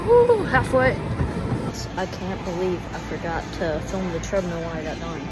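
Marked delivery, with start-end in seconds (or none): 0.1-0.9 higher, louder little cheer; excited whoop. Real, not acted. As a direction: cheer yourself on mid-run. Cheering mid-run.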